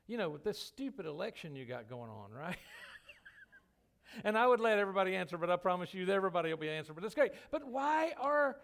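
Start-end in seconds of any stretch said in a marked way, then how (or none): 4.2-8.6 loud rush. Acted. Surge of energy after laughter.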